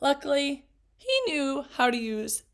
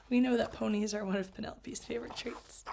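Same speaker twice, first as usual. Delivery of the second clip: fast secret whisper; 3 semitones lower, 6 dB quieter, faster than usual. Real, not acted. Teacher whispering a joke to the children.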